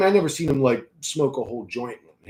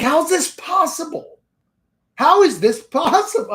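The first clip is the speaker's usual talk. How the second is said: can't believe what he's seeing; amazed disbelief; 14 semitones higher, 5 dB louder than usual. Retold or imitated. Watching Dwayne cash the whole bowl, amazed disbelief.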